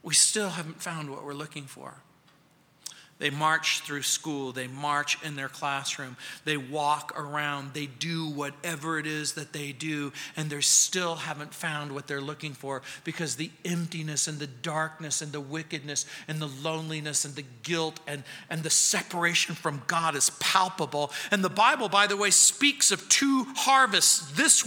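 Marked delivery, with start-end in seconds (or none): 0.0-2.0 low, hushed repeat of a line; quiet and grave, pitched low; sober reflection. Real, not acted. 3.2-21.1 low, soft, grave preaching; hushed, heavy-hearted reflection; sombre reflection. Real, not acted. Sombre reflection on lost students.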